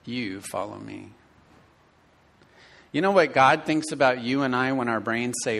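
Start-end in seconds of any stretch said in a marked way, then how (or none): 0.1-1.1 calm firm Jesus. Acted.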